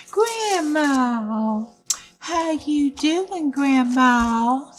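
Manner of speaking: sweet granddaughter checking in; lightly voiced little girl. A narrator's reading. Little Red greets grandma.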